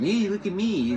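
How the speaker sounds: high-pitched mocking attention-seeker's cry; mock yell. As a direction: squeal it like someone begging to be noticed. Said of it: "We don't need to yell and scream".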